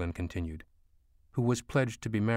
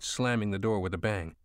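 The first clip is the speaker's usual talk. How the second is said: ordinary delivery, nothing marked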